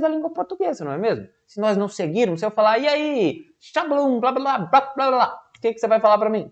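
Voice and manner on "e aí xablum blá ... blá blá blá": gibberish talk. Shown as a demonstration. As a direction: rattle off nonsense words playfully. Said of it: "eu não falei nada com nada".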